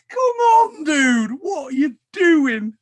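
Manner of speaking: high, loud cry of disbelief; exasperated shout. Real, not acted. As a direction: yell at your mate in disbelief.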